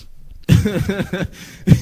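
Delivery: real high, bursting laugh. Real, not acted. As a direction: crack up at a viewer's joke. Laughs at a comment she reads.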